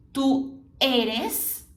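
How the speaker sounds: "tu eres" with a tight closed E. Shown as a demonstration. Teacher drills the closed E in "tu eres". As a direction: say "tu eres" with the E closed, not open. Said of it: "com E bem fechadinho".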